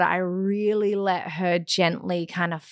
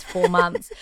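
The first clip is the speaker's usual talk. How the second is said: normal talking voice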